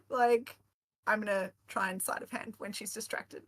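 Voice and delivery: normal talking voice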